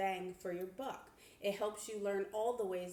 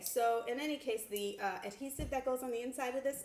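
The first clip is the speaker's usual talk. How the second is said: ordinary talk, nothing marked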